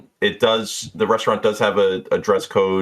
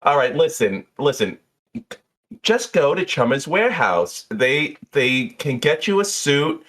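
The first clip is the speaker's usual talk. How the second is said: lightly voiced, enthusiastic bartender; a hint of a chatty, raised bartender voice; Jeannie; 7 semitones higher than usual. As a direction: talk up the shop, pitch swinging high. Game master plays bartender.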